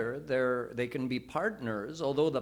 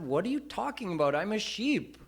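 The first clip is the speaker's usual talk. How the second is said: put-on confused young lion; 6 semitones higher, faster than usual. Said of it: "the younger lion said".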